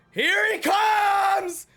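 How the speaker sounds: slow, high, loud yell; hype shout. Real, not acted. Fan announcing Garp's charge, a slow high shout. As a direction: stretch every word out in a yell. Garp attacks, fan yells.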